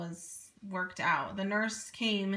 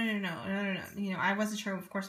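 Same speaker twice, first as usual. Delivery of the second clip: usual speaking voice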